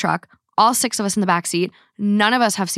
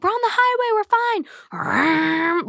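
cocky driver; 12 semitones higher, much slower than usual. Retold or imitated. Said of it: "He's like".

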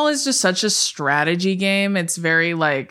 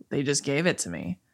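low, soft monotone; deadpan, dropped-down imitation; Bella; 4 semitones lower, 8 dB quieter than usual. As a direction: imitate an unimpressed assistant, quiet and low. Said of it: "It's very monotone".

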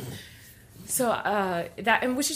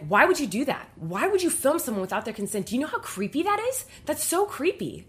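imitating an appalled telling-off, climbing high; retold scandalized scolding; indignant confrontation; 6 semitones higher, 3 dB louder than usual. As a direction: demand answers, louder at first then higher. Acting out the flight attendant confronting him.

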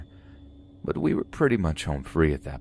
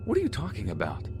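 snapping; 5 semitones higher, 4 dB quieter, faster than usual. A narrator's reading. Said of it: "she snapped".

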